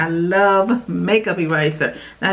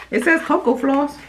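ordinary delivery

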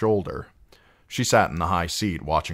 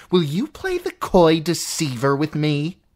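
higher and louder, sneering; an aunt cutting off her niece; scornful aunt; 11 semitones higher, 6 dB louder than usual. Acted. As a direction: cut her off with contempt. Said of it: "her aunt said".

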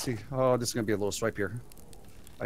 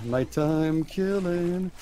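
mock ominous; 8 semitones higher, much slower than usual. Gloating over a night hunt.

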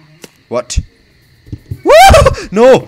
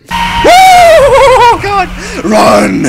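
panicked scream; 13 dB louder, much slower than usual. Real, not acted. Jump scare panic.

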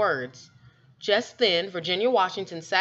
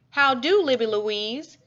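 lilting, higher greeting called out across the yard; a hint of a sing-song old lady calling; 5 semitones higher than usual. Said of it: "she called in her sing-song voice".

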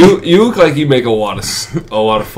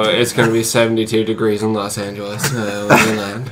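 pilot announcement. Pretend. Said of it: "do a pilot voice".

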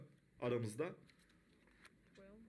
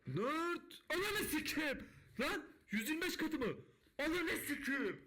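ecstatic yelling; 8 semitones higher than usual. Real, not acted. Streamer shouting to chat after a 125x hit, ecstatic. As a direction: yell in stunned joy.

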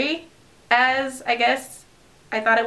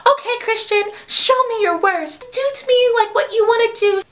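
mock daring girl; 11 semitones higher than usual. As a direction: tease him in a high girly voice. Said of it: "but she's just like".

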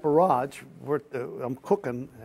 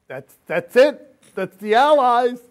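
higher, louder, slow amazed cry; joyful recognition; 9 semitones higher, 13 dB louder, slower than usual. Retold or imitated. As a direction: let the relief burst out at the sight. Seeing Allied tanks over hill.

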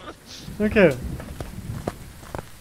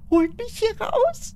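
scared plea; much slower than usual. Real, not acted. Trapped, calling for help.

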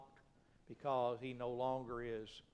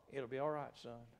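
gentle reassurance. Acted. God to his son gone into hell, gently reassuring. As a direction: drop the voice and settle him.

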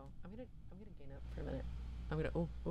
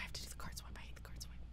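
whisper; 11 dB quieter, much faster than usual. Real, not acted. Muttering to herself while playing.

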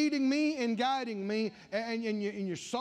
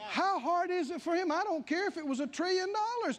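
preaching at full force; 9 semitones higher than usual. Acted. Preacher exhorting his congregation about God's might, full force. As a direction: thunder God's power over the crowd.

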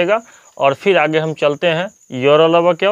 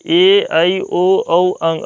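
slow letter recital; much slower than usual. Shown as a demonstration. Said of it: "यहाँ देखिए हिंदी में ए आई".

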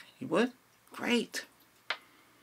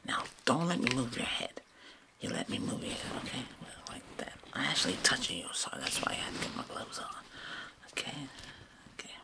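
whispering; 5 dB quieter than usual. Acted. ASMR roleplay whispering.

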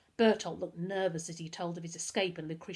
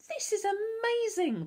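very high, stretched-out excited squeal; amazed delight; 5 dB louder, slower than usual. A narrator's reading. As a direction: gush in awe, loud and slow. Amazed girl watching beetle do tricks.

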